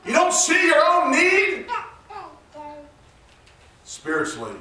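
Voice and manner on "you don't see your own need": very high, loud pulpit shouting; full-force preaching. Real, not acted. Preacher confronting a church that says it needs nothing, full-force preaching. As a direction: cry it out to the whole church.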